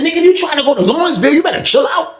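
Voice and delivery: fast, high-pitched mock threat; bucking up. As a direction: rattle off the warning in a high voice. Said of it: "trying to act like he bucking up at me".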